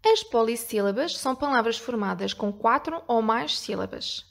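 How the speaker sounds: ordinary talk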